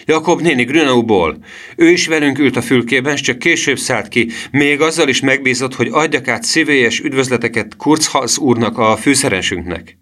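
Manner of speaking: high, eager insistence; boy's excited cry. A narrator's reading. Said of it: "kiáltotta Emil".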